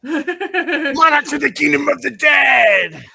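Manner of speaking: slow loud laughing shout; shouted announcement. Acted. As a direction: bellow like a metal frontman. Shouting band intro mockingly.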